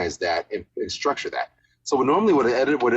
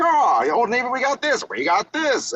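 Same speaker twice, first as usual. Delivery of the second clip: high, punchy newsreel voice; hammy old-time announcer. Shown as a demonstration. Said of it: "sound like a newsreel guy".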